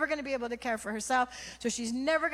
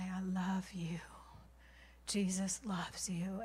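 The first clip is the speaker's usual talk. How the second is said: soft whisper, tender and slow; whispered comfort; 7 dB quieter, slower than usual. Retold or imitated. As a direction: whisper it close to her face. Comforting the mother, face held.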